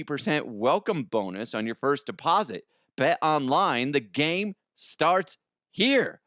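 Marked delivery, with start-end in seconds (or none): 3.0-6.2 ad read. Acted. Ad slogan tagline.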